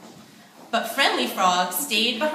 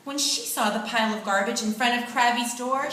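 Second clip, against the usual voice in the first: ordinary delivery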